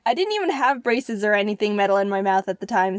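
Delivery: ordinary talk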